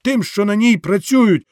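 rally the peasants with rising passion; soldier's speech to the crowd; fiery soldier orator. Acted. Wounded officer rallying the crowd.

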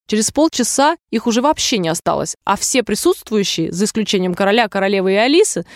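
usual speaking voice

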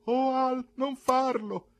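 high, loud woman's cry; pleading cry. A narrator's reading. Mercedes begging Al not to whip, high pleading cry. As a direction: cry out to stop him. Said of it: "intervenne Mercedes gridando".

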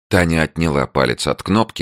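ordinary delivery, nothing marked

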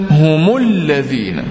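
هم said as humu, linked into الذين with a clear u; slow chanted humu lladhina; tajweed demo. Shown as a demonstration. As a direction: stretch هم into humu before الذين. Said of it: "فقلنا".

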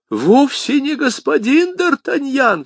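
very high, drawn-out outcry; astonished cry. A narrator's reading. Bonacieux sees the wrong man brought in, crying out. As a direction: cry out in disbelief. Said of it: "вскричал Бонасье".

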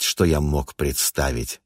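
usual speaking voice

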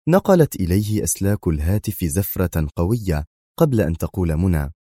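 plain reading voice

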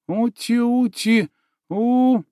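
high, slow cooing; cooing baby talk. A narrator's reading. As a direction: coo like to a pet. Cooing at the unicorn.

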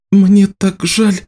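slow, high, sorry; whispered apology. A narrator's reading. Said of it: "прошептала Ники".

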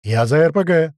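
loud eager shout. Acted. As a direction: call dibs at full volume. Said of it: "кричит упырь".

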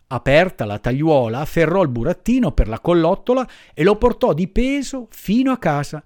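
plain reading voice

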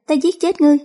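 high, vengeful outcry; vengeful threat. A narrator's reading. Bereaved mother threatening her daughters' killer, high vengeful cry.

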